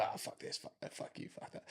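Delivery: swearing under the breath, almost voiceless; mock muttered swearing. Photographer pretending to curse at the couple, whispered. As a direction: pretend to grumble rudely in a hush. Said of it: "we don't obviously walk in and go".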